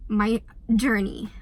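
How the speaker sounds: normal talking voice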